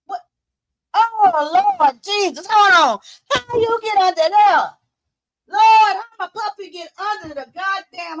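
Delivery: high-pitched distressed cry to the Lord; real panic, voice jumping high; alarmed. Real, not acted. Owner finding puppy stuck under the bed, alarmed.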